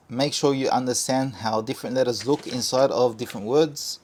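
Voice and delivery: ordinary talk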